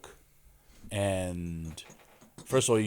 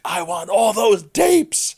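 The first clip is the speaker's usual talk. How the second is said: high, loud, slow frantic demand; freaking out; 12 semitones higher, 10 dB louder, slower than usual. Retold or imitated. Embarrassed boss yelling at the camera crew, slow high frantic shout. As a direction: demand every tape back, frantic and loud. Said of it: "he, like, freaks out".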